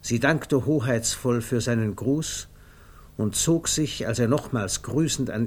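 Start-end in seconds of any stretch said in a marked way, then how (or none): none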